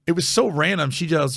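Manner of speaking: usual speaking voice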